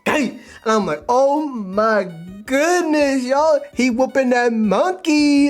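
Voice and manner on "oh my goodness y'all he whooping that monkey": high, loud, drawn-out astonishment; hyped reaction. Retold or imitated. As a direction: exclaim it big, stretching the words. Hyped reaction to comic panels.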